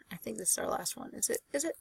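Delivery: whispered aside to herself; muttering to herself. Real, not acted. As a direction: whisper the check to yourself. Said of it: "Nope, we got two slides left".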